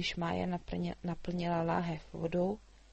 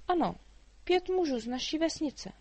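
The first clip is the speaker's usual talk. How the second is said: put-on much higher, lively girl's voice; a chatty girl teasing the dragon; little girl Mája; 9 semitones higher, 5 dB louder than usual. A narrator's reading. Girl answers. (vysoký živý dívčí hlas)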